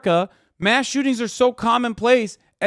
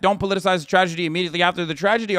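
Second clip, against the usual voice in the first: mock quote rattled off; 2 semitones lower, much faster than usual.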